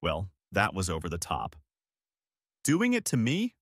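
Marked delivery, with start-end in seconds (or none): 2.6-3.5 scoffing question pitched high with contempt; derisive snort. A narrator's reading. Husband scoffing at his wife's words, derisive. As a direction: toss it back with a scornful snort. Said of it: "I snorted derisively".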